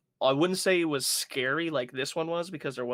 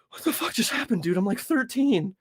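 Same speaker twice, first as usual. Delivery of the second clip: put-on high, rising voice of a shaken kid; stunned disbelief; 8 semitones higher than usual. Thirteen-year-old after surprise fight, high shocked outburst. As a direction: blurt it out still in shock. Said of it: "and you're like".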